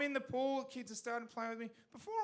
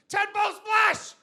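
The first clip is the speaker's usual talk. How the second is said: much higher, much louder slow roar of a move name; wrestling shout; 13 semitones higher, 19 dB louder, slower than usual. Retold or imitated. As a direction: bellow it like a wrestler leaping off the ropes.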